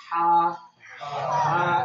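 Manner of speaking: long "हा" with soft friction from mid-throat; soft throaty ha. Shown as a demonstration. Teacher models the sweet-sounding ha for students, soft throaty ha. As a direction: draw out a smooth, breath-rich "हा" from the throat. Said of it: "मिठास वाली आवाज आनी".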